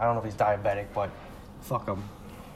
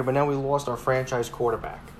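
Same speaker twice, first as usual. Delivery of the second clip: ordinary talk, nothing marked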